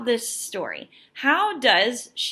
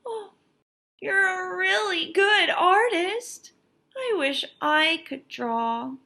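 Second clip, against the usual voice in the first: put-on higher little-boy voice, shy admiration; 9 semitones higher than usual. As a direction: sound like a small boy looking up in awe. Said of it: "he said".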